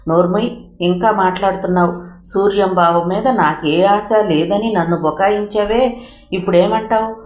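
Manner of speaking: ordinary delivery, nothing marked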